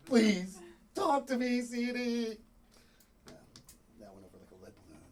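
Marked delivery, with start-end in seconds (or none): 0.1-2.4 high, loud theatrical plea; desperate begging. Acted. Man begs silent Siri to talk, hammed-up desperation. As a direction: beg them loudly to answer.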